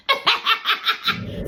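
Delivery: breathy high giggle; high unvoiced laugh. Real, not acted. Laughs after quote.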